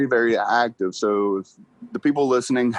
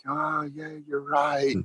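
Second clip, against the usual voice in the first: soft, sheepish "oh yeah, you're right"; mock sheepish concession to his wife; sheepish agreement; 7 dB quieter, slower than usual. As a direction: give in quietly, a bit embarrassed.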